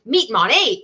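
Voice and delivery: hammed-up cheery headline; mock event title. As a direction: proclaim it with fake promo pep. Acts out announcing own event title.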